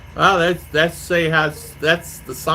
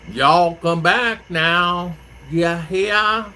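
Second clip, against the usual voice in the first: put-on very slow, stretched Southern accent, lightly higher; slow Southern drawl; 4 semitones higher, much slower than usual. Mimicking Mississippi family's slow talk, drawn-out Southern accent. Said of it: "And it'd be so slow".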